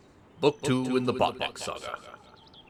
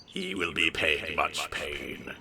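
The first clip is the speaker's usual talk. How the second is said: mock ominous; 8 semitones lower, 6 dB quieter, slower than usual. Dramatic reading of ominous line.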